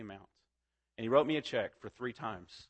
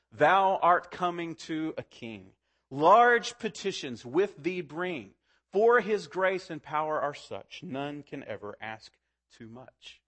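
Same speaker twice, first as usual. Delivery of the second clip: hymn recitation; 3 semitones higher, 3 dB louder, slower than usual. Acted. Preacher quotes John Newton's hymn to the congregation, declaimed slowly. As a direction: declaim each line slowly, then let the last drop.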